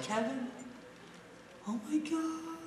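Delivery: high, soft gushing mom voice; excited mom. Retold or imitated. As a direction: gush like an overexcited mom.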